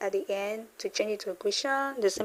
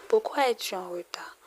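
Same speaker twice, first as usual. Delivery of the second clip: whispered; faster than usual. Shown as a demonstration. Teacher whispers the question to the class. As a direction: breathe it as a whisper.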